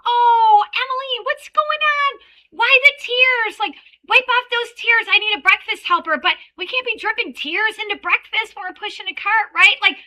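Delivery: put-on high, loud bright coaxing to a crying child; cheerful teacher. Teacher at drop-off coaxing crying Emily, high cheerful coax. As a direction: sweep the kid up with upbeat energy. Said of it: "Sometimes I say".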